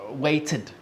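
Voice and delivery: "weighted" ending in a separate "id" syllable; careful model "weighted" in two syllables. Shown as a demonstration. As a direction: demonstrate "weighted" as "wait-id". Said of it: "it sounds like an I D".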